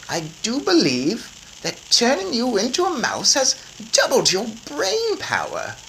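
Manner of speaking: put-on high, brisk old grandma; a grandmother taking charge. A narrator's reading.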